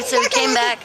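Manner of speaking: usual speaking voice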